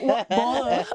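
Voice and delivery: laughing repeat of "War, war.". Real, not acted. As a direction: giggle the words out. Laughing with friends.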